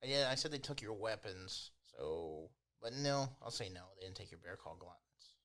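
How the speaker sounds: ordinary talk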